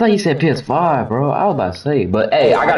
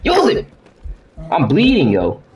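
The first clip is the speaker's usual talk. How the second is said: usual speaking voice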